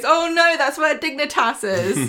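high, loud comic horror; hammed-up alarmed realisation; mock dismay. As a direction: wail it in pretend dread. Acting Sean's horror at Switzerland.

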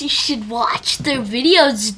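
normal talking voice

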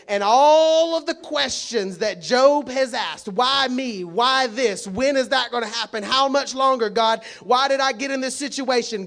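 high, loud, driving sermon voice; preaching at full force. Acted. Pastor thundering at the congregation about Job, full-force preaching. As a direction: hammer it home from the pulpit.

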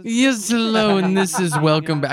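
hammed-up imitation of the video host; mocking the host's greeting. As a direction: squeak out his greeting high. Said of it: "This guy just has a crazy voice".

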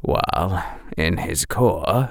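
usual speaking voice

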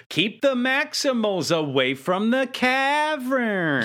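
mock villain projecting slowly to allies; loud projecting. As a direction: boom it out for everyone to hear. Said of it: "very loudly projects".

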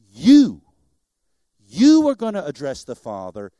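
YOU punched loud, then dropping low; emphatic YOU. Retold or imitated. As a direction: hit YOU hard, then settle into the rest. Preacher hammering who must pray.

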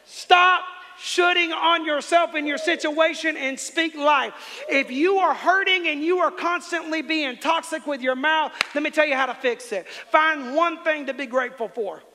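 loud, high preacher's call; full-force preaching. Acted. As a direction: preach it to the whole room.

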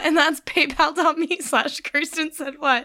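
usual speaking voice

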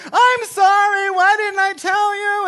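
very high, loud, slow scream; mock wailing apology. Jessica in heaven answering Jennifer, high mock screamed apology. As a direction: wail back in hammed-up regret.

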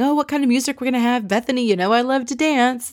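high, quick, eager party-lover imitation; Ramona. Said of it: "we hear Ramona saying".

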